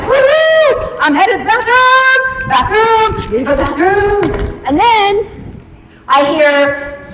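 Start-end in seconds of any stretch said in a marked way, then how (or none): 0.0-4.6 much higher, squealing joyful cheer; giddy excitement. Retold or imitated. Rushing off to the bathroom, giddy high cheer. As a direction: cheer it like a kid let loose. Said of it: "I'm like".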